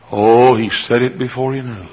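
ordinary delivery

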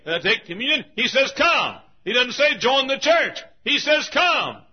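high, loud, hammering pulpit cadence; fervent revival preacher; full-force preaching. Acted. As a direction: drive each contrast home with a shout.

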